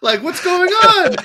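high, exasperated mock outburst; imitated exasperated complaint, high and insistent; Lloyd. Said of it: "Lloyd the whole time was like".

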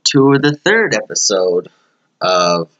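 bright, high show-host greeting; host intro. Acted. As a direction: open the episode like a radio host.

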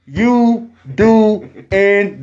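very slow, stretched question; drawn out. Acted. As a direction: stretch every word out.

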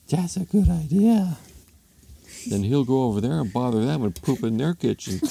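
put-on husband hatching an idea; scheming husband. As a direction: think up a sly plan as a cartoon husband. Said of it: "Husband comes downstairs".

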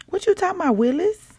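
high sassy what you talking about; Different Strokes catchphrase. Retold or imitated. Host quoting the TV show catchphrase to listeners, high and sassy. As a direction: quote the sitcom line with attitude. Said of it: "Y'all remember that show?".

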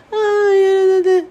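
a mock high squeal of a dismissive critic; mocking babble. Fan mocking critics' empty chatter, in a high mock squeal. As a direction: squeak it out as silly nonsense talk. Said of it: "whatever that is".

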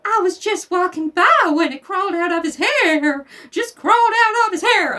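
high, loud desperate teacher; panicked, pleading to the class; hammed-up desperate teacher; desperately. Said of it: "Miss Caroline said desperately".

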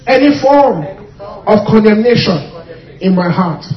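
loud, firm, stressed words; loud declaration. Shown as a demonstration. Preacher leads the final loud declaration. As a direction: declare it firmly. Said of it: "One more time, say".

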